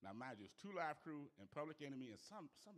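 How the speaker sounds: usual speaking voice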